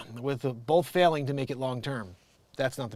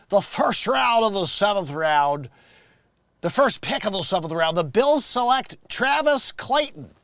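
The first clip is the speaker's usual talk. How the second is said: loud, slow announcer read, pitched up; draft announcer; 6 semitones higher, 8 dB louder, slower than usual. Pretend. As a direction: announce the pick with big drawn-out words.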